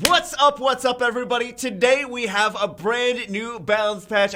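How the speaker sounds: loud, high hyped-up greeting; excited. Real, not acted. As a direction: open big, high and loud with real excitement. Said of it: "Very excited".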